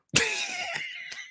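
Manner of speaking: high, light giggle. Real, not acted. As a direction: giggle at the joke. Laughs at own joke.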